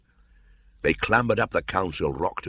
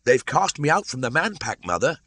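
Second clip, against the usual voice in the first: shouting; 9 semitones higher than usual. A narrator's reading. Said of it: "shouted Mowgli".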